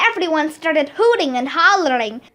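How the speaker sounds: usual speaking voice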